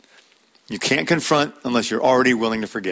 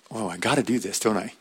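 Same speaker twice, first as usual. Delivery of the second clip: reluctant inner voice; 3 semitones lower, 7 dB quieter than usual. Retold or imitated. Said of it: "It might take more than a minute to get".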